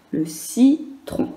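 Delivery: syllable by syllable. Shown as a demonstration. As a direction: slowly split into syllables. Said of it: "Première, c'est si".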